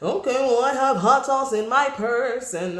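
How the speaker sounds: high, put-on voice courting voters; mock a politician awkwardly trying to fit in; pandering politician. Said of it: "politicians or something like that, where they're like".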